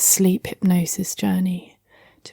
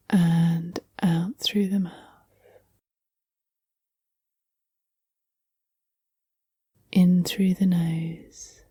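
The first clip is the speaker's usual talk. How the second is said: guided sleep hypnosis; 5 dB quieter than usual. Acted. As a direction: ease the listener gently towards sleep, unhurried and hushed.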